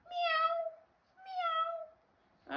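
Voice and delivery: high, squeaky cat meows. Acted. Dog George answering his mother, high cat meows. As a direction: mew twice like a kitten, very high. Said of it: "and George said".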